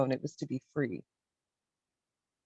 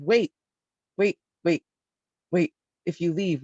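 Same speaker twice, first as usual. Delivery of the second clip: retold panic; 2 semitones higher than usual. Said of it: "felt this panic in myself".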